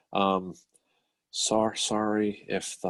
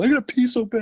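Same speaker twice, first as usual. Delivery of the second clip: whiny desperate plea; 14 semitones higher, 5 dB louder than usual. Retold or imitated. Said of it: "You're gonna".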